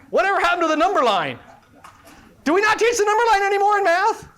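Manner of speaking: high, fast, playful disbelief; mock dismay. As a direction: act appalled in jest. Mock dismay at a student answer.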